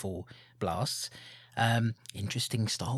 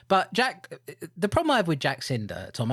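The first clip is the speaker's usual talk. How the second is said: ordinary talk, nothing marked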